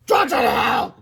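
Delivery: high loud furious shout; angry shout. Acted. Principal shouting at the boys. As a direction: scream it at them. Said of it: "shouted Mister Krupp".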